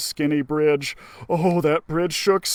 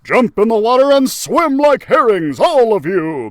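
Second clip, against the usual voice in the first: loud commanding order; 4 semitones higher, 7 dB louder than usual. Acted. Max ordering the mice across the river, loud. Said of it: "Max ordered".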